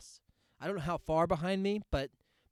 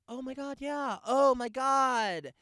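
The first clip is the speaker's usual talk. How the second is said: much higher, gushy imitation of a mom; the woman behind him gushing at her friend, much higher; 10 semitones higher, 8 dB louder, slower than usual. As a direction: coo like a delighted cheer mom. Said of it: "the woman behind me is like".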